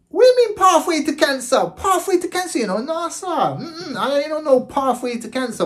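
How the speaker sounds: high, loud put-on voice of disbelief; mock indignant reaction. Retelling his reaction to the doctors, high mock outrage. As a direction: squeal it out like you can't believe the diagnosis.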